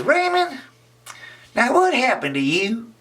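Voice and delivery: put-on very high girlish voice; little girl voice. Little Emily asks Raymond, very high girlish voice.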